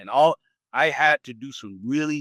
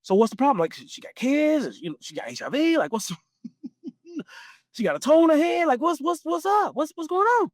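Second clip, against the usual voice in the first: uncle's high excited voice; 13 semitones higher than usual. Retold or imitated. Uncle grilling his nephew on the phone, high and excited. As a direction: play the baffled uncle firing off questions. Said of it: "He said".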